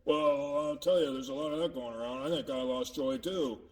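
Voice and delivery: gloomy and subdued; sad. Acted. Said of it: "Makes me kinda sad".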